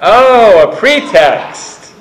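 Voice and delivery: a high, loud "oh" of discovery, then slow; sudden realization. Retold or imitated. Friend suddenly realizing the tears were a pretext, surprised. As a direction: it dawns on you all at once. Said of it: "my friend said".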